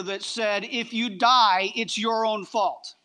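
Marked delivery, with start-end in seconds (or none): none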